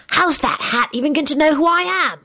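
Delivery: ordinary delivery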